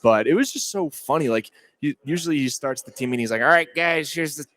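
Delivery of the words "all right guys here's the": higher mock coach voice; lightly voiced Midwest coach; imitating Stoops. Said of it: "He's got that Midwest accent".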